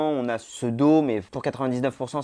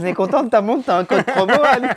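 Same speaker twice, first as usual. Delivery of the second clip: teasing; 9 semitones higher, 8 dB louder than usual. Pretend. Teasing ad about the watch.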